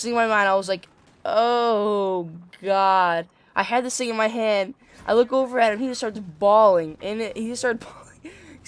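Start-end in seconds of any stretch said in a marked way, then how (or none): 1.2-3.3 drawn-out dread, retold; mock dismayed "oh God"; scared inner groan. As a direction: stretch it out like a sinking realization. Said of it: "I was so scared".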